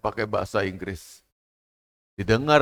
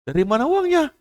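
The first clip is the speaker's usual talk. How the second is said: much higher, sneering doubtful gossip; mocking gossip; 4 dB louder than usual. Relatives gossiping doubtfully about the widow, high sneering voice. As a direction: scoff at the widow's hopes. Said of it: "Orang lain bersaudara kata".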